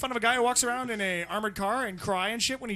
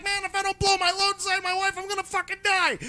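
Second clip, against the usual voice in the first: desperate husband; 9 semitones higher than usual. Retold or imitated. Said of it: "He's like".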